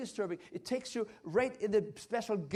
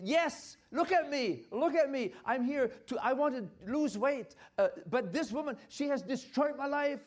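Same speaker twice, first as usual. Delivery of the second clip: upset patient; 5 semitones higher, 5 dB louder than usual. Retold or imitated. Overweight patient pleading voiced.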